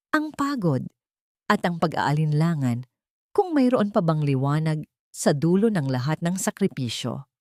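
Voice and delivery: usual speaking voice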